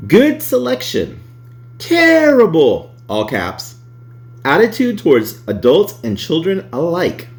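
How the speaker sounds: high, emphatic review read; dramatic reading. Acted.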